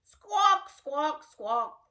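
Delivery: high squawk falling off; parrot squawk. Pretend. Teacher squawking like a parrot for children. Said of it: "The parrots in the jungle say".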